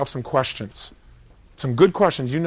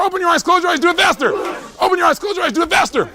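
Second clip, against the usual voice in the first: higher and louder with each rapid command, building to a laughing shout; speeding-up rapid commands; 10 semitones higher, 3 dB louder than usual. Shown as a demonstration. Coach rushing the audience through the drill, building from urgent to a laughing shout. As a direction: drive the drill faster and faster until it cracks into laughter. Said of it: "do it really fast".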